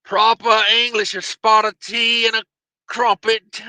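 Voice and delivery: high, loud-ish mock British; cartoonish fake British accent; bad British accent. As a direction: play up a silly proper English accent. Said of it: "It's the worst British accent ever".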